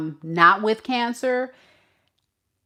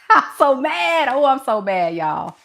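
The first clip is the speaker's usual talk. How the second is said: high, louder burst with a laugh in it; worked-up mad; 5 semitones higher, 4 dB louder than usual. Real, not acted. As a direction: vent exasperation, half laughing at yourself.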